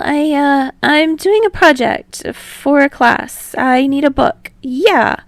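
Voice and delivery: bashful, stumbling explanation; sheepish. A narrator's reading. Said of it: "Hinata explains, sheepishly".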